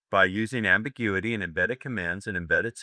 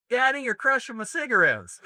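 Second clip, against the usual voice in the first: much higher put-on girlish voice; Southern girl voice; 4 dB louder than usual. Coach tells the old joke in a much higher girl's voice. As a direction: squeak it like a teenage Southern girl. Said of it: "fourteen-year-old Southern girl say to her lover".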